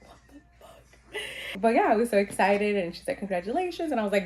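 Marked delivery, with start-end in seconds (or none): 0.0-1.6 slow, drawn-out whisper; stunned whisper. Retold or imitated. Mother-to-be hearing how many weeks along, stunned whisper. Stunned reaction to pregnancy news. 3.2-3.9 high, singsong imitation of a clinician; pitched-up retold well-wishing; cheery congratulations. Clinician to newly pregnant patient, cheery.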